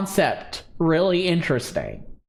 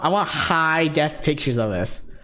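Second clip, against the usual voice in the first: ordinary delivery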